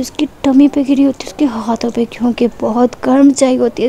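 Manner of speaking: usual speaking voice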